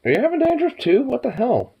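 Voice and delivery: a squeal of disbelief at the pet. Real, not acted. Owner to her dog, high surprised baby talk.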